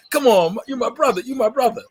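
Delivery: guilt-trip him as your brother, laughing; mock brotherly plea. Friend pleading for a brother discount, loud high laughing plea.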